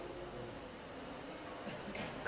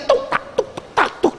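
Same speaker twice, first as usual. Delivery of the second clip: mock rapid prayer noises; 13 semitones higher than usual. Mimicking hasty prayer sounds.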